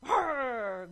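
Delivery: loud imitated lion roar; big storybook lion growl. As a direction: roar out fiercely in play. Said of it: "growled again".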